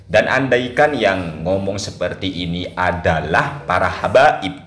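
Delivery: usual speaking voice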